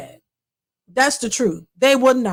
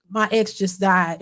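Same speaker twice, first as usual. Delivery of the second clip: low, slow, subdued confession; imitated mournful man; retold grieving boyfriend; 5 semitones lower than usual. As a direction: play him downcast, slow and low. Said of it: "And he was like".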